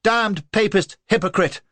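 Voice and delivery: high, loud furious shout. A narrator's reading. Lola shouting after the fleeing man, high and furious. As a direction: hurl it after him. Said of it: "shouted after him".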